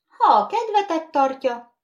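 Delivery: high, loud lordly reply; condescending. Acted. Bogeyman answering the eager skittles, condescending. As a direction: grant it from above. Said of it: "felelt a mumus leereszkedően".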